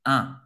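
Un said fully nasal; nasal vowel. Shown as a demonstration. Teacher isolates the nasal un. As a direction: hum Un through the nose. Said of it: "bem nasal".

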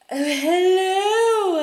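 high, loud, drawn-out hello; charming actor greeting. Retold or imitated. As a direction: greet her like a suave celebrity. Actor greets her at convention.

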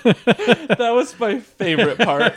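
laughing through the words, slowly. Real, not acted. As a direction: giggle while saying it. Laughing while recalling chimes.

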